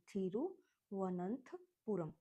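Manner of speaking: Thiruvananthapuram said slowly, syllable by syllable; teacher spelling it out slowly. Shown as a demonstration. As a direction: break Thiruvananthapuram into clear slow parts. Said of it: "ऐसे आप learn कर लीजिएगा".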